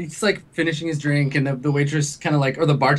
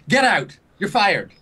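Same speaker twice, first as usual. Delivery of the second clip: higher-pitched, punchy dismissal; mock angry firing; 5 semitones higher, slower than usual. [name] fires the bartender, mock angry. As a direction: play the boss firing someone on the spot.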